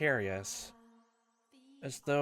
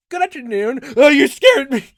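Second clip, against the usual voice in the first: startled greeting; 10 semitones higher, 16 dB louder than usual. Acted. Greeting a startled boy on shore.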